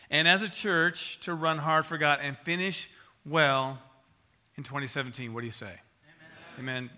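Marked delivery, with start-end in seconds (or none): none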